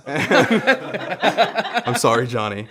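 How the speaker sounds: slow, giggling sorry; laughing apology. Real, not acted. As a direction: apologize while cracking up. Mock apology while laughing.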